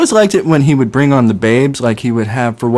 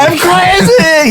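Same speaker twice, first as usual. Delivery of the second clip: crazy pitchman; 4 dB louder, much slower than usual. Retold or imitated. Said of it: "he would go".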